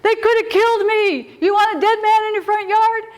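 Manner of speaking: high, strained outraged complaint; angry man. Retold or imitated. Big angry man confronting the mother at her door, outraged high voice. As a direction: rail indignantly at the parent. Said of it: "He was big and angry".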